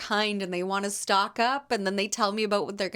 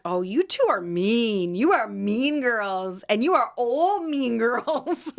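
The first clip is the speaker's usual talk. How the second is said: mock scolding; 3 semitones higher, 3 dB louder, slower than usual. Teasing the mean women.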